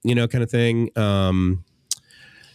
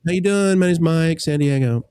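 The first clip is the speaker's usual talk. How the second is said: AA meeting introduction; 7 semitones higher, 3 dB louder than usual. Retold or imitated. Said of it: "maybe if I'm in A A meeting".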